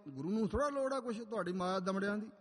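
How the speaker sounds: normal talking voice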